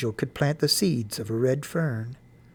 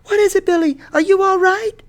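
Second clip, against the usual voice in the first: high, loud alarmed call back; frightened shout; 7 dB louder than usual. A narrator's reading. Frightened mother calling back to her son, shouting. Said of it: "In a frightened voice, she shouted back".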